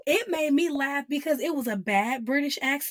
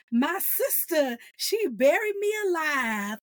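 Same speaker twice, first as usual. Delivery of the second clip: imitated Southern drawl, drawn out; Southern accent; 4 semitones higher, slower than usual. Fan quoting the character about his sister, Southern accent. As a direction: stretch it in a mock Southern accent. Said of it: "doing a Southern accent this whole time".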